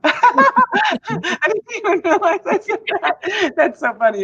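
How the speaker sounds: laughing through the words, high. Real, not acted. Host laughing at her own accidental do do. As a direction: giggle it out, amused at the slip.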